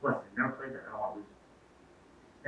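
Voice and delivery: quiet, slowing, almost whispered refusal; mock-serious, hushed and dead earnest. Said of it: "really serious".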